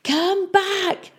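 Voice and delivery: high-pitched desperate call; shouting. A narrator's reading. Peavy shouting after the town from ahead, high and desperate. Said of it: "they could hear Peavy shouting".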